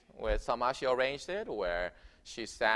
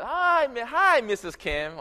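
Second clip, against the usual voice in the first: high, bright mock American neighbor greeting; the American neighbor opens the door to Mrs Kim; neighbor's cheery greeting; 12 semitones higher, 8 dB louder, slower than usual. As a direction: sing out a friendly hello at the door.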